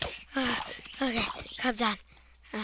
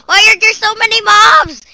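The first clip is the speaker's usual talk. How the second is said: panicked; 8 semitones higher, 7 dB louder than usual. Real, not acted. Overrun by mobs.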